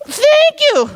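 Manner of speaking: high squealed thank you through a laugh. Real, not acted. To the one listener who brought cake, a squealed laughing thank you.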